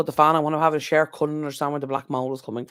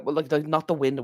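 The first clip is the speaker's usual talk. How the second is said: usual speaking voice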